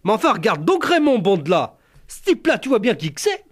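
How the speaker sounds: high, thrilled rustic woman; excited country wife. Retold or imitated. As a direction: nudge the husband, all excited. Wife voiced in the story.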